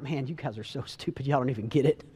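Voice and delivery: scornful. Retold or imitated. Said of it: "we're like".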